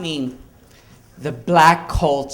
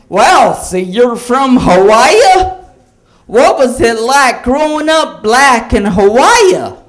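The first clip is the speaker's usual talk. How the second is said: loud, slow, higher mock tourist voice; tourist impression; 6 semitones higher, 7 dB louder than usual. Curious tourist asks the comic about Hawaii, loud and slow. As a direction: ham up a clueless tourist asking. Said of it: "he came up to me and he said".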